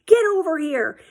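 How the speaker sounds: high, forceful command; angry dad ordering. Retold or imitated. Dad ordering the stranger down the bar, angry.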